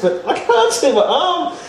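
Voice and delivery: high, slow, laughing through the words; laughing disbelief. Retold or imitated. Retelling to the host, laughing in disbelief. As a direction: laugh at how strange it was. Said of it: "But I know, I know I've got my arm".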